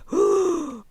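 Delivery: a shocked little intake of breath; retold crowd gasp; mock gasp. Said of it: "everyone is like".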